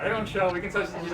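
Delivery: ordinary delivery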